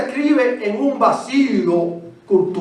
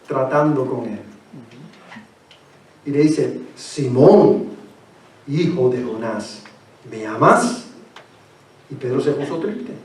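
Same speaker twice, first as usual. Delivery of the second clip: soft, lower voice for the third question; tender and low; 9 semitones lower, 4 dB quieter than usual. Acted. Preacher voicing the Lord asking Peter a third time, tender and low.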